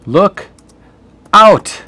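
loud, high cry of look out; shouted warning. Shown as a demonstration. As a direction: yell it as a warning. Teacher acts out the warning.

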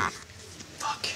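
breathy hushed curse; stage whisper. Acted. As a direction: whisper it so the room hears. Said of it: "Whispers like this".

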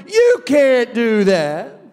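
a drawn-out mock taunt; mocking doubter voice. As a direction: mimic the voices that doubt you. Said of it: "voices that tell me".

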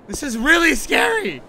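rising alarm. Real, not acted. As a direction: blurt it out, scared. Repeats remark louder, scared.

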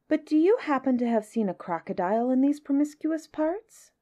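most polite. A narrator's reading. Elephant's child questions the crocodile, most politely. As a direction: ask as a well-mannered young elephant. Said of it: "said the elephant's child most politely".

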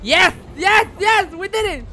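loud, high cheering; excited shouting. Real, not acted. Gamer cheering the escape, loud and high. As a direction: cheer the win at full voice.

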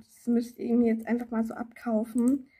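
normal talking voice

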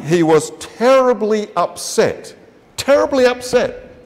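「terribly」を高く引き伸ばして強調; stress on terribly. Acted. Dwelling on Jesus' distress.

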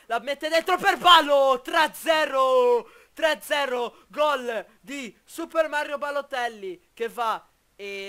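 loud rising goal call; breathless italian goal commentary. Acted. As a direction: build up and shout the goal. Live goal call in game.

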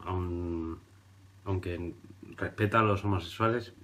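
normal talking voice

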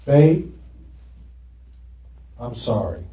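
quiet sorry; plain apology. Shown as a demonstration. Preacher showing the church how he apologized to his wife. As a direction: say sorry softly and simply. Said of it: "here's how I repented".

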